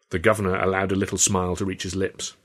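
usual speaking voice

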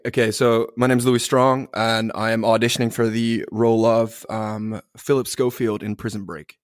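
British speaker doing an American accent; audition-style American accent. Shown as a demonstration. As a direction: introduce yourself in a put-on American accent. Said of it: "talk with my American accent".

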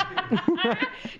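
high-pitched giggle; real high cackle; amused laugh. Real, not acted. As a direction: burst out laughing at your own impression. Laughing at mother's exactness.

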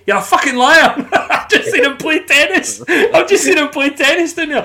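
high, slow mock accusation through laughter; retelling the manager's tennis jab, laughing. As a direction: call out the lie while cracking up. Manager catching Gaza lying, laughing.

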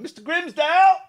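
high, loud shouted call. Retold or imitated. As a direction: yell the name across the ward. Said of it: "it's them going".

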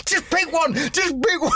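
very high-pitched mock pleading; imitated exasperated wife. Wife to her dithering husband at the ice cream counter, mock exasperated. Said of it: "his wife went".

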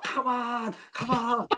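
high, eager mock rallying call; winding up the crowd. Player at the corner flag geeing up the fans, high and eager. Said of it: "And I'm winding up".

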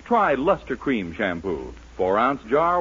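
usual speaking voice

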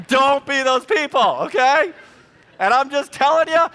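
loud, high comic rant; mock scolding. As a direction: playfully shout a warning at picky customers.

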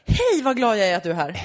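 loud, bright mock welcome; mock cheerful greeting. Imagining grumpy locals' real meaning, a loud mock-cheerful greeting. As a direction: overdo a happy hello as a joke. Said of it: "det kanske är ett så här".

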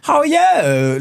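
very high mock Cockney woman; high-pitched Cockney masseuse impression; Cockney twang. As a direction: squeal it in an imitated Cockney twang. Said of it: "Cockney twang to her voice".

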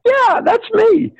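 very high, drawn-out delight; giddy high-pitched self-recognition; gleeful agreement. Acted. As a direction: claim it in a high squeal. Chris excitedly matches himself to idols.

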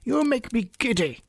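high-pitched royal screech; screamed complaint. A narrator's reading. Queen scolds bowing gardeners, high screech. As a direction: complain shrilly as the irritated Queen. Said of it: "screamed the Queen".

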